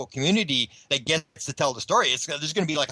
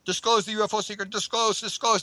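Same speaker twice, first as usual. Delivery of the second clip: mock yelling; 6 semitones higher than usual. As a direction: chant it insistently like a heckler, half-voiced. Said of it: "standing there yelling".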